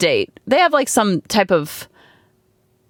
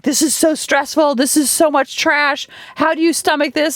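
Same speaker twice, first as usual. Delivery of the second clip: higher-pitched mock distress; imitating exasperated dad; 7 semitones higher than usual. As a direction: act out a dad who can't stand the show. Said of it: "he couldn't stand it".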